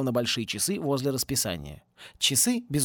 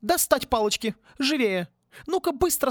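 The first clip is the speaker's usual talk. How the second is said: commanding; 10 semitones higher than usual. A narrator's reading. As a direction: order the boys sharply. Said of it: "скомандовала". (командует мальчишками, высоко и громко)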